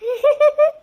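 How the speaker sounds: high mocking cackle; mocking laugh. Aunt laughing at her niece's misfortune, mocking cackle. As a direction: laugh at the girl behind her back. Aunts mock the girl.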